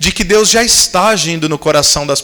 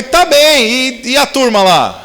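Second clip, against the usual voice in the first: agudo e alto, entusiasmo forçado e lento; disfarçando constrangido; 8 semitones higher, 4 dB louder, slower than usual. Retold or imitated. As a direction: finja que lembra, exagerando a alegria. Pastor disfarça que não lembra. (pastor constrangido disfarça com falsa animação)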